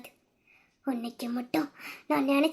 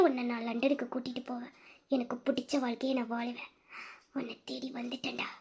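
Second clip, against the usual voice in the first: low, near-whispered determination; hushed vow; 3 semitones lower, 9 dB quieter than usual. Acted. Lover vows to win the beloved back, hushed and low. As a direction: murmur it low and soft, like a secret vow.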